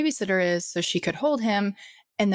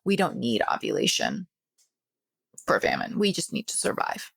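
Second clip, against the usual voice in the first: the body talking; 5 semitones higher than usual. Retold or imitated. Host voices the body in a famine, lightly mocking. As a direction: play your body deciding to shut down. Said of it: "Your body goes".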